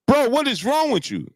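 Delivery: high, incredulous rebuke; fed-up, disbelieving question; exasperated. Real, not acted. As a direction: challenge him in exasperation, pitch swooping up high. Berating the boxer he criticises.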